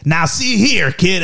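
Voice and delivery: high-pitched mock hillbilly; hillbilly accent. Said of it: "like, hillbilly accents".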